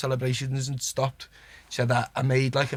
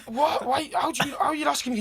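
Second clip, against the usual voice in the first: incredulous; 12 semitones higher than usual. Retold or imitated. Retold shock at Klopp's question.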